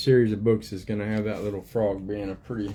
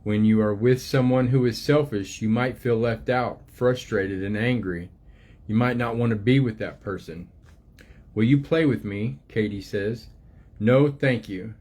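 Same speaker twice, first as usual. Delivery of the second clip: gentle storybook reading voice, unhurried; reading to children; 3 dB louder than usual. Acted. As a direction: read it like a picture book to little ones. Reads book aloud to children.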